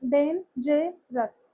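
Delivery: डेन जे रस slowly, one syllable per beat; slow broken डेन जे रस; split into syllables. Shown as a demonstration. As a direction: chop डेन जे रस into three even parts. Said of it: "three parts में divide हो सकता है".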